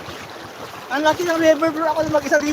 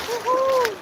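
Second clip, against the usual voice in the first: high, whooping cheer; excited whoop; 11 semitones higher than usual. Real, not acted. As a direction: whoop out with joy. Cheering in the river.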